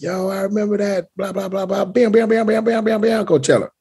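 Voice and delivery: high, soft mock-hype voice; mock hyped reminder. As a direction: run off a high-pitched string of hype noises. Imagined excited reminder to audience.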